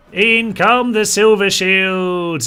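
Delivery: loud, slow, drawn-out proclamation; triumphant battle cry. Real, not acted. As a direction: bellow the troops' entrance, stretching it out. Climax of hailing his troops' arrival.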